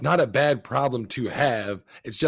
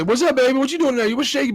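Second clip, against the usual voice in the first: mock catcaller; 11 semitones higher, 4 dB louder, faster than usual. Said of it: "When a man comes up to you and say".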